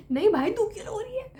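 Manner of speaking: can't believe she cries; pleading disbelief. Retold or imitated. Friend crying over good marks.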